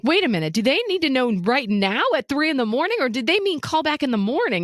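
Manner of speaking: high-pitched, incredulous questioning; mildly frantic retold question; retold alarm. Retold confused question to boyfriend.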